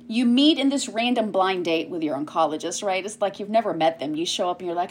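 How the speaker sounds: usual speaking voice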